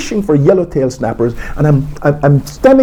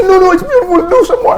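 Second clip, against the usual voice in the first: much higher, eager voice of the lab colleague; bright high-pitched encouragement from Shelley, a little louder; delighted colleague; 5 dB louder than usual. Retold or imitated. Retold colleague praising his recitation.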